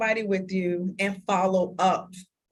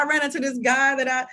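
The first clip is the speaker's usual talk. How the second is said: normal talking voice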